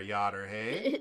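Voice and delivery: slow drawn-out Upper Michigan Yooper; lightly mocking Yooper drawl; Yooper accent. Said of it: "everyone thinks that's what Wisconsinites sound like".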